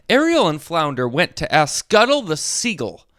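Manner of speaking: plain reading voice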